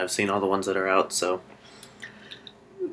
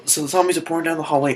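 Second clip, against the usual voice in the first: ordinary delivery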